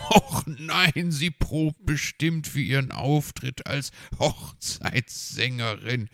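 high, loud groan of realisation; dawning dismay. A narrator's reading. Fox realising she rehearses for the wedding, dismayed outcry. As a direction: groan it out as it dawns on you. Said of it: "fiel es ihm wie Schuppen von den Augen".

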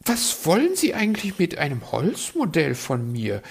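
high, prying bird voice; high curious magpie. Acted. Magpie asks.

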